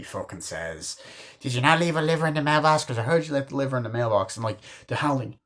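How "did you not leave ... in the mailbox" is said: fast, higher-pitched character quote; imitated rapid-fire wisecrack; Randy. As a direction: rattle off an excited film line. Quoting film character Randy.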